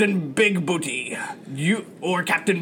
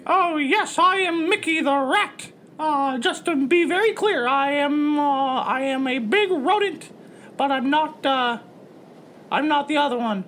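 high, careful mascot voice; squeaky rodent, politely explaining; cartoon rat first mate; 8 semitones higher than usual. Rat insists he's not the other rodent, high cartoon voice.

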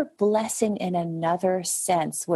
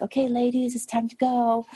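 raised, hesitant plea to the dancers; timid polite call; 7 semitones higher than usual. Retold or imitated. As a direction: ask nicely and expect to be ignored. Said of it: "she's like".

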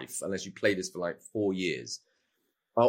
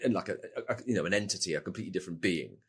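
softer, detached grandiose wizard; aloof magical being; 4 semitones lower, 7 dB quieter, faster than usual. Retold or imitated. Wizard as a distant magical entity, soft and aloof. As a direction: sound above it all, otherworldly. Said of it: "rather than just being".

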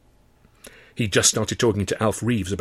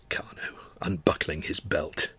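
whispered; 5 semitones lower, 7 dB quieter, slower than usual. A narrator's reading. Intrusive memory read hushed.

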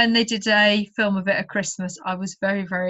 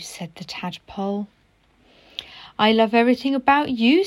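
storybook read-aloud voice; story narration; slower than usual. A narrator's reading. Teacher reading a story to pupils, gentle storytelling. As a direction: read the picture book to children.

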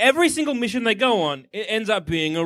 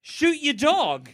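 mock order; 6 semitones higher, much slower than usual. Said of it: "And then they're like".

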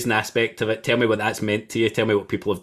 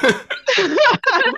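high squealing laugh; 6 dB louder than usual. Real, not acted. Host cracking up at guest's story.

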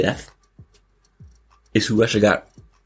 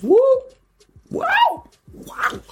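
excited squeal; much slower than usual. Real, not acted. Cheering for Brittany Griner.

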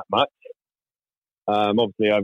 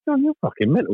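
incredulous; 11 semitones higher than usual. Retold or imitated. Incredulous retold reply.

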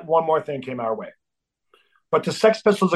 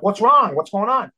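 concerned question; 5 semitones higher, 3 dB louder than usual. Retold or imitated. Asking upset bandmate what happened.

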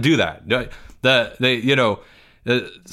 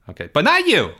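put-on whiny, higher kid voice with big complaints; Porge; 7 semitones higher, 3 dB louder than usual. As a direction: play Porge, indignant and put-upon.

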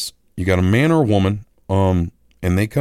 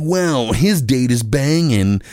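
impressed friend; 2 semitones higher, slower than usual. Retold or imitated. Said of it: "he is like".

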